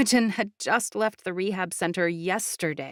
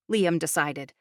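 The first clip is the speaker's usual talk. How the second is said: plain reading voice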